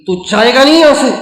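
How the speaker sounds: mother yelling again at her son; angry demand. Retold or imitated. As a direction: snap at the son, still furious. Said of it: "دوبارہ غصے میں کہنے لگی".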